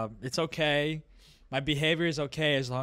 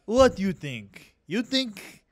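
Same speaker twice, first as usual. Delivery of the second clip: slow, deliberate mock Romanian accent; halting Romanian-accented challenge; 3 semitones higher, much slower than usual. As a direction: play a Romanian heckler, drawing out each word. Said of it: "I'm the Romanian guy".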